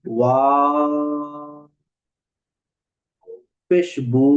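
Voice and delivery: ওয়া then পেশ বু, with বু held one alif long; vowel lengthening demo; stretched vowel demo. Shown as a demonstration. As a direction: draw out বু like a teacher showing madd. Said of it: "এক আলিফ টেনে পড়তে হবে".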